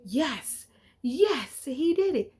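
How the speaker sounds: building glee at solving it; excited realization. Retold or imitated. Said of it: "I was like".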